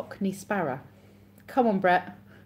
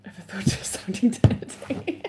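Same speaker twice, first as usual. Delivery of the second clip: whisper; 3 semitones higher, 4 dB louder than usual. Acted. Reading a naughty chat line.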